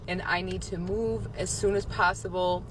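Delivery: normal talking voice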